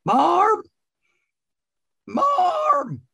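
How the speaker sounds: very high-pitched, drawn-out holler; shouting into a cave. Acted. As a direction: call out loudly for Marm, stretching every word high. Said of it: "he shouts into a cave nearby".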